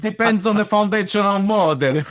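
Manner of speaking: hammy fake Italian accent. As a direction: say it like a cartoon Italian chef. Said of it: "Nice fake Italian accent there".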